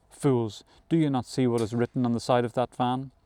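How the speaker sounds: ordinary delivery, nothing marked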